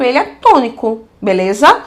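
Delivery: usual speaking voice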